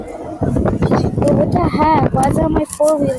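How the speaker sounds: yelling across the yard; shouting. Real, not acted. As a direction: shout it out in outrage.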